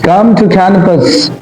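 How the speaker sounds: usual speaking voice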